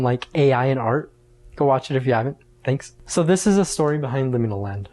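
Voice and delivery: ordinary talk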